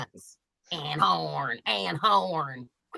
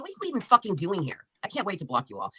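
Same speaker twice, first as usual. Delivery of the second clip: usual speaking voice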